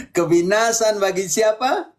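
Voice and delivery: slow, leading question; drawn-out quizzing question. Real, not acted. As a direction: stretch the question out slowly for the pupil.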